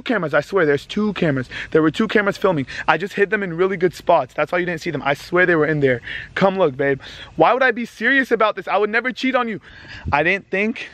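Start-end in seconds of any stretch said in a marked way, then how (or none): none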